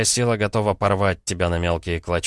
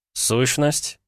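high-pitched wry one-word query; wry question; 7 semitones higher than usual. A narrator's reading. Son names the gift to his father, wry. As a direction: toss it back wryly, pitch up. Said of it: "криво усмехнулся Петя".

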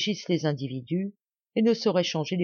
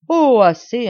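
a sharp, much louder, higher cry of enough; imperious outburst; 7 semitones higher, 13 dB louder, slower than usual. A narrator's reading. Young woman silences her poor aunt, loud imperious shout. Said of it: "regards impérieux".

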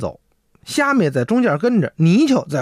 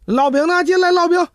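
high, raised call for the food; 11 semitones higher than usual. Acted. Monster guests shouting to the innkeeper for flatbread, raised high call. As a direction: bang the table and call him in.

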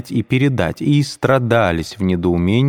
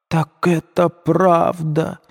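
high, slow astonished cry; alarmed exclamation; 9 semitones higher, much slower than usual. A narrator's reading. Мать потрясённо вскрикивает, высоким голосом. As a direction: cry out as the truth sinks in. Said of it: "вскричала Пульхерия Александровна".

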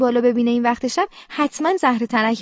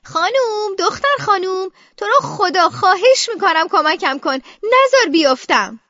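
drawn-out, higher-pitched begging; pleading cry for help; 6 semitones higher, 4 dB louder, slower than usual. Acted. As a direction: call out desperately, stretching the words.

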